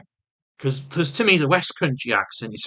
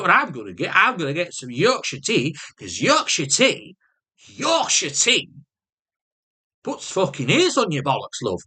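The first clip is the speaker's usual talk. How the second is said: put-on Yorkshire accent; 6 semitones higher than usual. Partner praising Yorkshire tea to him, mock Yorkshire accent. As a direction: stretch it out in hammed-up Yorkshire. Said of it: "sometimes she do a Yorkshire accent".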